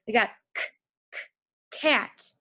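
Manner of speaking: k, k, cat with hard K repeated; sounding out cat. Shown as a demonstration. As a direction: stretch k before cat. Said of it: "So let's sound out cat".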